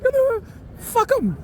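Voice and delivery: cranky pet sounding off at the noise; Eddie Brother ranting. Acted. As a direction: rant indignantly as the cat. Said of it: "Eddie Brother is ranting".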